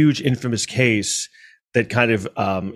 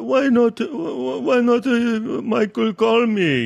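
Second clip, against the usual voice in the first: much higher put-on voice of a nagging woman; imitating the woman; 12 semitones higher, slower than usual. Narrator mimics the woman demanding why Michael won't call, much higher put-on voice. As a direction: squeak out her complaint, slow and stretched.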